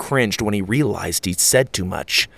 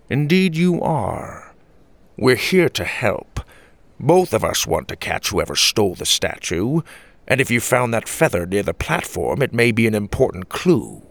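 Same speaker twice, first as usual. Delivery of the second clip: grandpa voice. A narrator's reading. Grandfather to Achara, deliberate and measured. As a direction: speak with an elder's weight.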